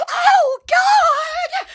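breathless thrilled cry; overwhelmed gasp. Real, not acted. Overwhelmed by the sensation.

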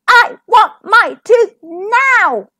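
high, slow, insistent shout; loud demand. A narrator's reading. Little princess refusing the dentist's offer, loudly demanding. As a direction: hammer out each word as a demand.

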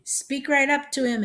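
put-on high, loud old-lady call. A narrator's reading. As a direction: call it across the room. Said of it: "Aunt May called out".

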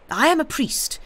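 very high, agitated declaration; febrile, loud and pitched right up; unsteady cry. A narrator's reading. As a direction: cry it out, wrought up. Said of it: "he cried unsteadily".

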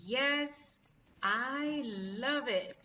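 drawn-out, emphatic "I love it", stretched word by word; slow model phrase. Shown as a demonstration. Teacher shows students how to say me encanta, slow and emphatic.

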